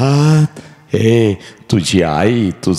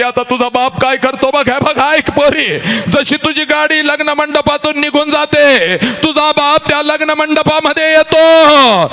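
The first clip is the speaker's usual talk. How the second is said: 1 full-force preaching. Acted. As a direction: drive it like a kirtan peak.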